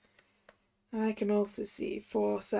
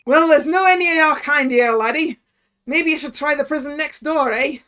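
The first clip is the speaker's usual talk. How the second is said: loud, dismissive Scottish governor; Scottish accent; 2 semitones higher, 7 dB louder than usual. Acted. Governor turns the foreign visitor away, Scottish accent. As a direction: send them away in broad Scottish. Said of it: "okay, we gotta do Scottish".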